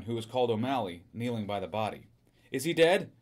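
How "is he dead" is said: raised, higher-pitched question; anxious cry. A narrator's reading. Wife asks policeman kneeling by the body, mildly crying out. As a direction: cry out, a touch of alarm. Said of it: "she cried".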